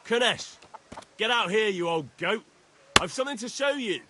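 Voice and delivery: calling out. Acted. Calling a debtor out of house.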